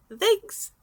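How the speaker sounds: high, loud cheery chirp; a sudden high, loud chirp of thanks. A narrator's reading. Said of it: "Izuku chirped".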